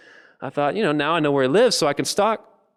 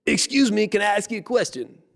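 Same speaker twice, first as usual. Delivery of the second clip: high-pitched stranger voice; man asking a favor; 9 semitones higher than usual. Acted. Stranger at the car wash stops him, high pleading character voice. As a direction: pipe up high to get someone's attention.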